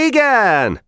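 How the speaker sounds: put-on high, eager boys' reply; chorused answer. A narrator's reading. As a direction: answer out brightly like a whole group. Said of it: "felelt az egész gittegylet egyszerre".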